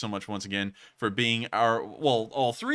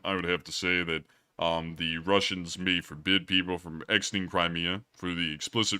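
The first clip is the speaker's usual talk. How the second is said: lower voice; 3 semitones lower than usual. Pretend. Streamer answers the question in a put-on lower voice. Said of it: "answer your question in an even lower voice".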